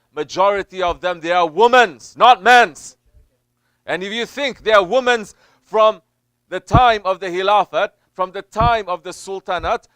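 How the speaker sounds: loud, impassioned sermon voice; fiery preaching. Acted. Preacher warning the congregation, loud and impassioned. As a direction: preach it out, rising and hammering.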